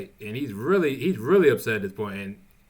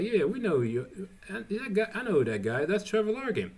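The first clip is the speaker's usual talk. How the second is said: painter's recognition; 9 semitones higher than usual. Retold or imitated. Painter guy recognizes Trevor.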